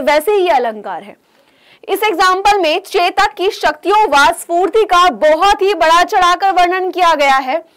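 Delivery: ordinary talk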